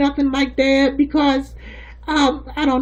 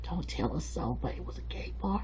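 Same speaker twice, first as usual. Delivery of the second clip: dropping to a near whisper; soft gossip murmur sinking to a whisper; hushed secret; 9 semitones lower, 12 dB quieter than usual. Acted. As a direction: confide it so nobody else hears. Telling a secret, don't tell.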